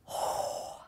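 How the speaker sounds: long, steadying breath; breathy sigh before starting; deep breath. Acted. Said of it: "Deep breaths".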